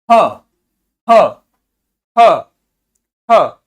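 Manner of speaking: ह as short separate bursts; abrupt, repeated ह syllables; isolated sound demo. Shown as a demonstration. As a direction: punch out each ह alone, with a pause between. Demonstrating an isolated sound to learners.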